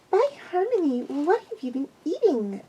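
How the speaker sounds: high, strained filly complaint; high rude complaint; rude pupil voice. A narrator's reading. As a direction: groan it out like a cheeky girl. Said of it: "she asked me quite rudely".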